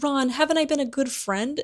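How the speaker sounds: high-pitched wheedling plea; pleading Pettigrew. Retold or imitated. Cornered traitor appeals to the boy, pleading. Pettigrew begs Ron for help.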